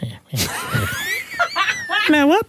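real burst of high laughter; laughing. Real, not acted. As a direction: crack up mid-protest.

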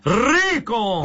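high, drawn-out exclamation; catchphrase cheer. Pretend. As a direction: sing out the punchline with relish. Host answers the sign-off cheer.

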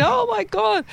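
very high, held-back mock squeal; pretend scream. Medium pretends what the client screamed out, mock scream. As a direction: fake her scream up high without shouting. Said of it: "I'll just pretend what she screamed out".

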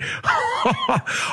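high, soft burst of laughter; amused laugh. Real, not acted. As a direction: laugh lightly at the long odds. Laughs at the betting odds.

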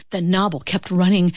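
plain reading voice

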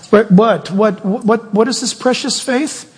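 usual speaking voice

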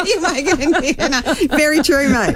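repeating the caller's line through high laughter; real amused laugh. Real, not acted. Host laughing at caller's rugby joke.

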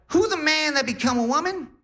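very high, insistent squeaky repeat; Cat Williams. Retold or imitated. Grandma repeats for baffled grandson, very high Def Jam-style voice. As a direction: imitate grandma pressing the question in falsetto. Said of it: "she was talking in a crazy accent, like, uh, like Def Jam comedy, like Cat Williams".

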